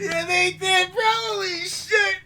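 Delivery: high-pitched, laughing disbelief; real giddy astonishment, voice way up; amazed hype. Real, not acted. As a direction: squeal it out, stretching the curse. Hyped reaction to a plot twist.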